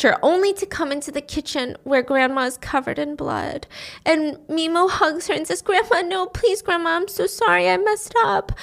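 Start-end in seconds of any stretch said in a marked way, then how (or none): 5.6-8.5 high, distressed acted plea; tearful pleading. Mimo to her bleeding grandma, high pleading apology. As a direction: beg in a high, grieving voice.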